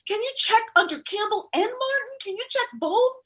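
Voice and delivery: high, urgent begging; anxious actress begging the front desk; frantic pleading. Retold or imitated. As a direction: plead in a rush of panic. Frantic plea to casting desk.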